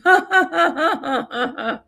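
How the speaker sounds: long high-pitched run of laughter; hearty laugh. Real, not acted. Remembering how hard mom laughed, cracking up.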